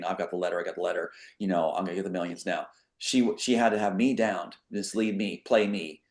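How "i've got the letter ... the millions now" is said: lower, softer mock gloat as her; imitating her gloating. Man imitating the woman on taped calls, mock gloating. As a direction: quote her smugly under his breath. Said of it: "calling me saying".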